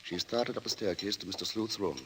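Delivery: measured storyteller's voice; radio drama narrator setting the scene; story narration. A narrator's reading. Narrator joins the radio play.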